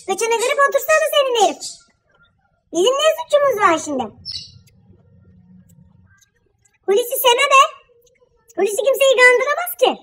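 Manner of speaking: a village wife bristling; indignant scolding. Acted. Wife on the phone defends her husband, heated and indignant. Phone quarrel between neighbour wives.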